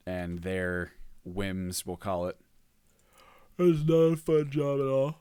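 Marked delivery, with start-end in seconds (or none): 3.6-5.2 high, loud, hammed-up wail; playful high-pitched complaint; mock lament. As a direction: wail it in comic exasperation, coming down at the end. Mock lament about dramatic modders.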